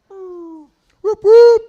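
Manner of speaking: high siren whoop ending in a loud burst. Retold or imitated. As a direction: mimic a police siren. Imitating police siren.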